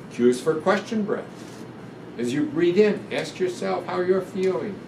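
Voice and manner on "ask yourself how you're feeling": ordinary delivery, nothing marked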